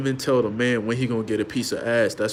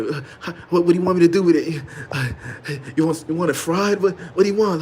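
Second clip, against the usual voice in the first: higher, louder, fast nervous questions; flustered husband; 6 semitones higher, 5 dB louder, faster than usual. Retold or imitated. Husband asking wife how to cook the eggplant, flustered. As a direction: scramble to please. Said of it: "he be like".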